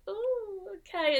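bright high "Oh," then a tight "okay"; trying hard to sound pleased; forced cheer. Retold or imitated. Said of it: "I was trying to be like".

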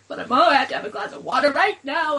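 mock the kid's last bedtime demand; whiny daughter. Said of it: "finally she's like".

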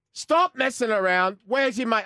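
slow, loud, high imitated manager yell; mock furious gaffer bellowing; angry shout. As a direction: demand it like a furious boss. Manager demanding where player is.

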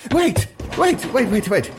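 very high, frantic cries of wait; man facing a levelled crossbow, panicking; panicked pleading. Acted. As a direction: beg him not to shoot. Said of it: "sweated the man in front of him".

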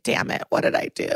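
lightly acted, small plaintive voice, softly; sad. Crying suspect asks what she has done, mock-sad. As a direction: imitate a suspect despairing at herself. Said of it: "She's so sad".

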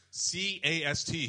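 slow, loud letter-by-letter correction; spelling it out. Retold or imitated. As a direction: spell it for someone who keeps mishearing. Handler spells out the misheard word.

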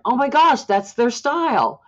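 high, loud burst of alarm; an aghast cry of oh my gosh; shocked exclaim. Retold or imitated. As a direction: blurt it out in shocked recognition. Reacting to news of murders.

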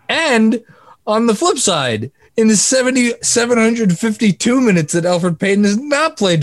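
stunned disbelief at the numbers; incredulous. Real, not acted. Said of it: "I can't even say it with a straight face".

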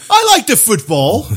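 put-on playful British accent voice. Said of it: "Say it like a stereotypical British guy".

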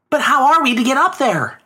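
lightly voiced boy wondering; boy asks. A narrator's reading. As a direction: ask it like a doubtful child. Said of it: "asked the boy".

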